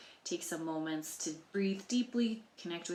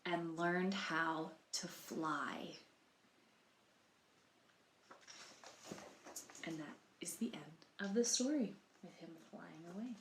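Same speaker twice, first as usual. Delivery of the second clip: soft ending whisper; 2 semitones lower, 9 dB quieter than usual. Real, not acted. Reader closing the story for children, whispered ending. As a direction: let the story drift off quietly.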